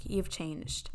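low, soft mock disappointment; let-down reaction. Sister to her changed brother, quietly disappointed. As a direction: drop it low and quiet, disillusioned. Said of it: "that was, like, my literal reaction".

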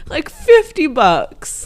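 slow, loud indignant complaint; outraged. Real, not acted. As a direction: drag it out in disbelief. Outraged at lantern price.

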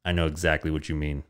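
hammed-up deep hushed agreement; Vin Diesel voice. Vin Diesel nods to Mark, low hushed parody voice. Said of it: "He's like".

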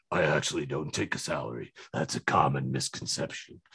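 deep, rumbling dragon voice; Smaug voice. Retold or imitated. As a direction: speak like a huge smug dragon on a hoard. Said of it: "Smaug, the dragon, just saying".

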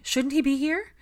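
high, loud, worried question; anxious. A narrator's reading. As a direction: press anxiously for an answer. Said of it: "Katie asked anxiously".

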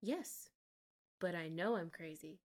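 imitating unhinged calm. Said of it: "Athena goes just like this".

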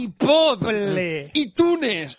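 loud, high IPOD and ITUNES read as in Spanish; exaggerated Spanish pronunciation. Shown as a demonstration. Co-host joining the brand joke, exaggerated Spanish reading. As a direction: belt out "iTunes" as "ee-TOO-nes".

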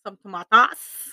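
"tomatoes" as "to-MAH-tahs", slow and loud; playful accent. Pretend. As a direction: draw out "tomatoes" with ah vowels and a rising end. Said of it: "You have to add accents to them".

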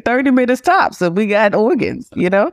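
high, gushing TV-drama voice; quoting Grey's Anatomy. Retold or imitated. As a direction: sell it like a TV miracle. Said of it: "You're quoting a T V show here?".

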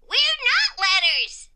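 high, loud, drawn-out exclamation; emphatic explanation. A narrator's reading. Exclamation Point explaining to the letters, high emphatic exclamation. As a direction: correct them with gleeful force. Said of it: "Exclamation Point explained".

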